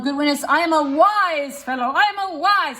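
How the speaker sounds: wise leaned on proudly; I am a wise, wise said with pride; self-important stress on wise. Shown as a demonstration. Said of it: "I would emphasize that".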